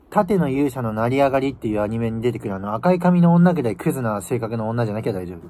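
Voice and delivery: ordinary delivery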